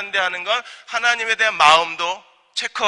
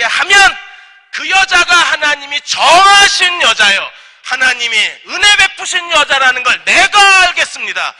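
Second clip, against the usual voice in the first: high, forceful acted prayer; emphatic declaration; 7 semitones higher, 8 dB louder than usual. Servant setting God a test for the bride, emphatic declaration. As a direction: proclaim it loudly as a bold condition.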